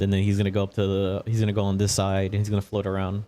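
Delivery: ordinary delivery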